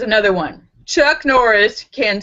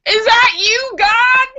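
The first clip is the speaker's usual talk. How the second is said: very high, loud shout; shouting to God; 13 semitones higher, 6 dB louder, slower than usual. Acted. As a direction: yell up to the sky in awe. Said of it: "The stoner shouts".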